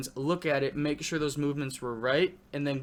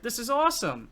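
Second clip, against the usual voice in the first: excited; 10 semitones higher, 4 dB louder than usual. Retold or imitated. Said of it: "and I was like".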